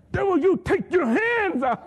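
high, loud, slow commanding shout; yelling at the devil. Retold or imitated. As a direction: cast the devil out with force. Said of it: "I'm yelling, you know, at the devil".

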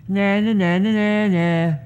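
put-on sing-song childish taunt. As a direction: tease it like a playground chant. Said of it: "she can go".

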